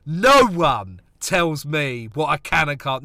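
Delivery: ordinary talk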